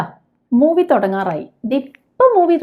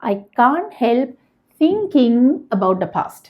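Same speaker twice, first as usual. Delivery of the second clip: slow model sentence; much slower than usual. Shown as a demonstration. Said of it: "teacher models English sentence".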